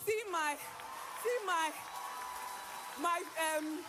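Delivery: real exultant praise, voice lifted high; excited exultation. Real, not acted. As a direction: shout out the miracle with joy. Testimony climax praising miracle baby.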